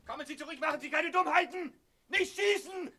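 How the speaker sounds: high, pleading calls; desperate calling. Acted. Reggie pleads with Erik not to shoot, desperate calls. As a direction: beg him not to shoot.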